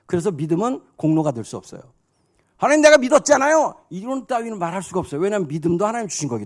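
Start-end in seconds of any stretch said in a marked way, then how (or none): 2.6-3.8 loud, high mock protest to God; acted believer pleading their case loudly; indignant protest. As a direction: protest your credit loudly, pitched high. Mimics a believer claiming credit.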